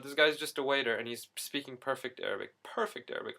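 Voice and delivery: ordinary talk, nothing marked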